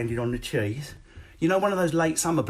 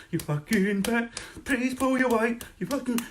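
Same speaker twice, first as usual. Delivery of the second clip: hammed-up seething spouse; mock fuming wife; 9 semitones higher than usual. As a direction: vent at the pillow in a put-on voice. Imitated frustrated spouse at pillow.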